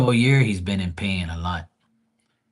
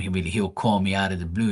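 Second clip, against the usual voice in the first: ordinary talk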